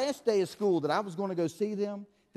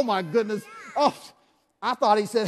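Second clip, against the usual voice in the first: usual speaking voice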